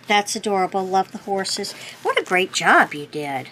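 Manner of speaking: normal talking voice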